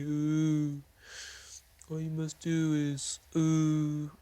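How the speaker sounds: high, drawn-out ghost voice with moans; ghostly prophet. Acted. Spirit tells the player to avenge him, slow ghostly wail. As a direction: intone the prophecy like a wailing spirit.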